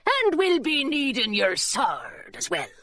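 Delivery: slow, husky pirate drawl; drawn-out sailor voice with little voicing; pirate deckhand. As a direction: demand it as a pirate mate. Pirate mate demands the sword.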